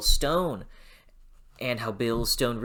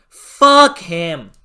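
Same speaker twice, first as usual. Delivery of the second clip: "Fuck him" pushed out much louder, stress on "Fuck"; louder angry curse; 4 semitones higher, 12 dB louder than usual. Real, not acted. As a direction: finish the build with a hard "Fuck". Repeated curse, building up.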